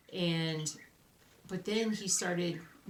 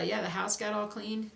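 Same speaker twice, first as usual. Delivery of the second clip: usual speaking voice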